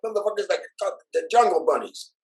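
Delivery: high, loud sneering cop impression; mock a sneering cop, pitched high. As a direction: ham up the cop jeering at them. Mocking the racist cop.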